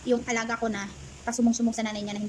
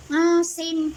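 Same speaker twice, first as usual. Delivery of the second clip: high, loud mock of the employer; employer's exclamation; 7 semitones higher, 8 dB louder than usual. Helper mimics employer naming the price, high loud exclaim. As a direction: blurt it like the boss. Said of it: "Sabi niya".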